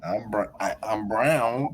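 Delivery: ordinary talk, nothing marked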